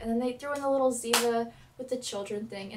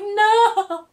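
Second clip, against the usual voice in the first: high, loud mock scream of no; retold scream; 7 semitones higher, 13 dB louder than usual. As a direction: yell it like the crash just happened. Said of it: "I was like".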